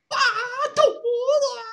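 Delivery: very high, laughing falsetto whine. Acted. Mock whine fleeing the cops.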